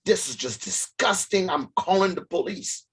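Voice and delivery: lightly voiced indignant manager; stern manager. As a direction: tell him off like a shocked store manager. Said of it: "He goes, he goes".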